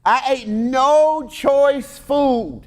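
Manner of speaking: high, slow, solemn "I ate no choice food"; mock Daniel. Preacher showing how Daniel opens, high and slow. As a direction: pitch up and stretch it like a prophet. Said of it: "he starts it off with that".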